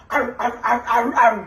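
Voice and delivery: mock barking growls; imitated dog barking. Shown as a demonstration. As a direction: bark like a dog to scare them off. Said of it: "Sasabihin mo". (panggagaya ng tahol ng aso)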